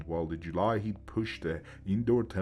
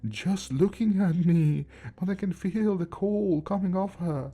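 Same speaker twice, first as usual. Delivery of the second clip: loud and high with fear; shaken, voice pushed up high; frightened old man; 9 semitones higher, 5 dB louder than usual. Acted. Grandpa describes the ghost.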